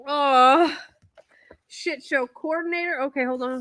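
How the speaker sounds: normal talking voice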